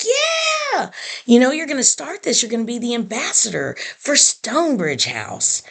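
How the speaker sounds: high, eager burst that eases as it goes; excited cheering. Retold or imitated. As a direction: imitate a thrilled son cheering. Son cheers her on.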